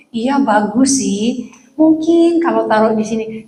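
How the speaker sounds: drawn-out, tentative politeness; stretched, hedging diplomatic answer; hesitant, indirect pastor. Acted. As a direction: ease into the suggestion very slowly and gently. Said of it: "kan beda kalau orang Batak".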